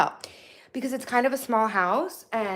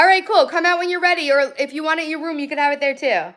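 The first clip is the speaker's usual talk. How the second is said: loud, raised voice to another room; high, projected parental call; 8 semitones higher, 8 dB louder, faster than usual. Real, not acted. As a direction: holler a casual reply across the house. Answers child in other room.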